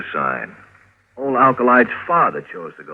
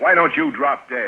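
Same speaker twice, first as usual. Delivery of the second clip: higher, sharp retort; angry snap; 11 semitones higher than usual. Acted. Edgar snapping at meddling Meg, angry retort. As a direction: snap it at her in disgust.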